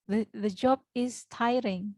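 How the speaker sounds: slow, clear teacher model of the sentence; slow deliberate teacher demo. Shown as a demonstration. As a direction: spell it out slowly for the class. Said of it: "teacher models sentence".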